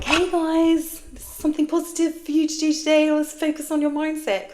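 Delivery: high, loud, bright-eyed upbeat pitch; hammed-up chirpy positivity voice; mock cheery influencer. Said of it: "come to the internet and be like".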